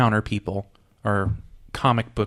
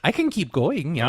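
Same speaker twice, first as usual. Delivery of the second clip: higher put-on German accent; 3 semitones higher than usual. Host threatening to continue, in a German accent. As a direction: tease in a mock German accent. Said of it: "start calling you Gru".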